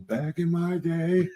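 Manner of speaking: slow, lightly voiced old man; grandpa voice. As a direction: put on a hint of an old grandpa. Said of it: "I got my grandpa voice ready".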